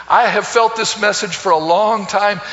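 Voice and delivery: usual speaking voice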